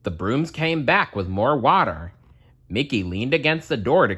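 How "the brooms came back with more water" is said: ordinary delivery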